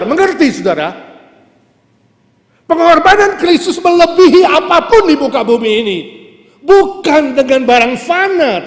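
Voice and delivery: loud, high-pitched shouted proclamation; impassioned sermon shout; full-force preaching. Real, not acted. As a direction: thunder it out to the congregation, stretching key words.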